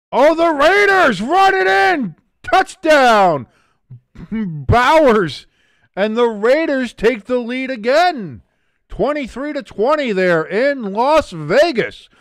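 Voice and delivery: high, loud touchdown call; excited call. Real, not acted. Streamer calling the Raiders touchdown, high and loud. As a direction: burst out with the score.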